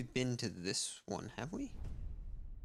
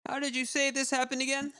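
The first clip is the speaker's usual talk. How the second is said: doctor voice; 12 semitones higher than usual. Acted. Same doctor character.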